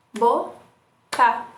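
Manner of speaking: Boca split into two beats; syllable demo. Shown as a demonstration. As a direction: clap out each syllable. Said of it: "como na palavra boca". (Boca separada em duas batidas)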